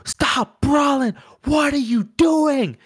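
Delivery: high, slow, pleading shout from the corner; imitating frantic corner yell. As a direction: cornerman begging fighter to stop brawling, high slow yell. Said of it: "yelling at him".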